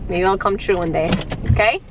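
ordinary talk, nothing marked

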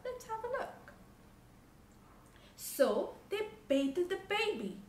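usual speaking voice

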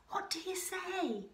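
high, playful invitation; coaxing chorus. A narrator's reading. Crowd joining in to invite Kevin out, coaxing. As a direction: coax them with a lilting question. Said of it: "the crowd all joined in".

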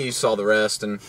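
ordinary talk

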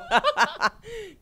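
big high burst of laughter; loud laugh. Real, not acted. Actor bursting out laughing at the director. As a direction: crack up loudly.

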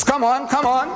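high mocking come on, come on; a preacher mimicking a gloating rival, high and taunting. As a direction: egg him on, sure of the kill. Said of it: "started stamping his feet".

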